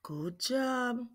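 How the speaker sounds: soft whispered praise. Acted. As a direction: breathe it as a whisper.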